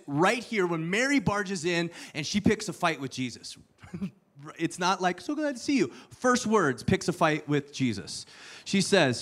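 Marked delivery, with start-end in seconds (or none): 5.2-5.9 mock sweet greeting.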